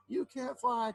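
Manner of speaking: mock twitter from the little bird. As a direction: tease like a chirpy bird. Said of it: "twittered the bird".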